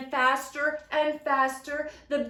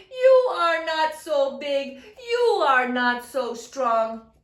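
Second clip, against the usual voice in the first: loud, high teasing taunt; mock the cow, laughing; 4 semitones higher, 8 dB louder than usual. A narrator's reading. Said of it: "laughed Zomo".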